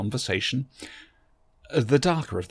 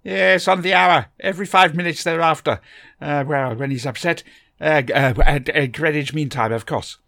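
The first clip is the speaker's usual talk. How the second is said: put-on higher and louder, fussy old gentleman; eccentric Doctor voice; 5 semitones higher, 10 dB louder than usual. A narrator's reading. The Doctor greets Barbara from behind, a higher, louder fussy old gentleman. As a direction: bustle in as a fussy, kindly eccentric.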